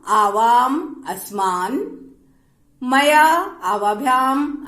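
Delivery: slow, drawn-out Sanskrit forms for a class; declension recitation. Shown as a demonstration. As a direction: recite form by form with held endings.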